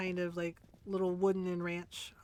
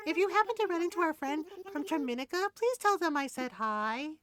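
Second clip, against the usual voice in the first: very high, quick sweet voice; high cute villager voice; 9 semitones higher, 3 dB louder than usual. Acted. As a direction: chirp it like a cartoon animal. Reads villager dialogue.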